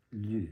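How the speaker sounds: Lou said as a plain "loo", no i sound; Lou, a single "loo" sound; French pronunciation. Shown as a demonstration. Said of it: "It was just pronounced Lou".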